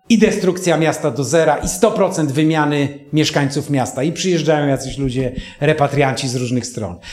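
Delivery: usual speaking voice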